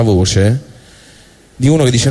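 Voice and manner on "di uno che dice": ordinary talk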